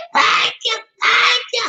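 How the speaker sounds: very high playful falsetto; comic sky-high thanks; squealed catchphrase. Pretend. Signature thank-you catchphrase squealed.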